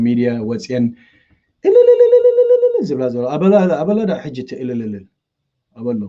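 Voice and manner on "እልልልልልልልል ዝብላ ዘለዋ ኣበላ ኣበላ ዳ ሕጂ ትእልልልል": mock ululation. Mocking women ululating online.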